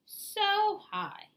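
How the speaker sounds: "so" lifted high and drawn out; stretched high "so". A narrator's reading. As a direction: sing "so high" way up.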